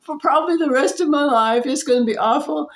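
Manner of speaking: half-laughing dread of going to jail; panicked thought. Retold or imitated. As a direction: inner voice spiraling into fear. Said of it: "and I thought".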